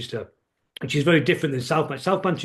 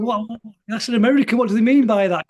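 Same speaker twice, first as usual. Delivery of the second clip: raised, louder puzzled questioning; recalled bafflement, pitched up and punched louder; baffled reaction; 6 semitones higher, 5 dB louder than usual. Retold or imitated. Fan recalling his confusion at an American lyric, baffled.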